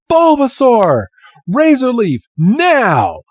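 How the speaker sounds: very high, loud battle call; shouted command. Retold or imitated. Red orders Bulbasaur to attack, shouting. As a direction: yell the attack at your Pokemon. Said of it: "He's like".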